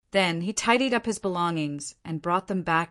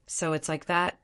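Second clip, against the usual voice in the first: ordinary delivery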